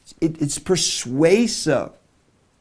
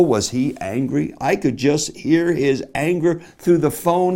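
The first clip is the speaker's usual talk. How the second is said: usual speaking voice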